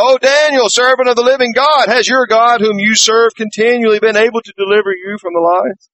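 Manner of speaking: anguished cry. A narrator's reading. King calling into the lions' den, anguished high cry. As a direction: cry out to Daniel in the den, fearing the worst. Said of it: "he cried out in a tone of anguish".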